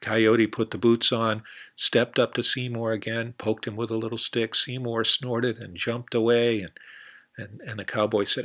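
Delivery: ordinary delivery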